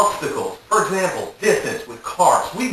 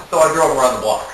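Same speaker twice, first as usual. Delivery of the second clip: usual speaking voice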